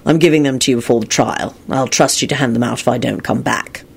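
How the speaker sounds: low, subdued tired voice; weary. A narrator's reading. Lupin entrusting letters before the trial, weary. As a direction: say it wearily, resigned. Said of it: "Lupin replied wearily".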